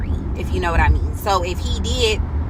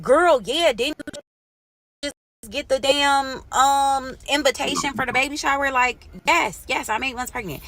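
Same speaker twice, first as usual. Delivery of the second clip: exasperated; 6 semitones higher than usual. Retold or imitated. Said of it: "I'm like".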